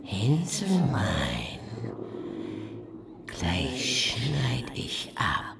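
slow, sinister whisper; slow menacing witch whisper. Acted. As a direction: whisper the threat like a rhyme. Witch threatening the boy.

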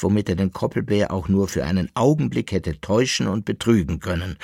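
ordinary delivery, nothing marked

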